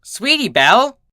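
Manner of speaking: a scolding yell over laughter; shouted name. Acted. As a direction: call her out sharply, scolding. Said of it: "Twilight shouted".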